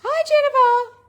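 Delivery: excited greeting. Real, not acted. Greeting a viewer on stream.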